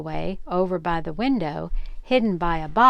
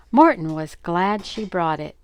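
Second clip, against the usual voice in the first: plain reading voice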